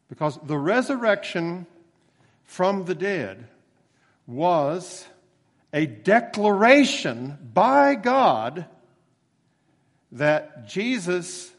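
slow, loud, each phrase hammered; emphatic build. Real, not acted. Preacher proclaiming resurrection to congregation, slow and emphatic.

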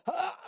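high sharp yelp of frustration; mock snapping outburst. Pastor imitating himself snapping at his wife, high yelp. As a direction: snap out with a sudden cry. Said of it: "I'd go to go".

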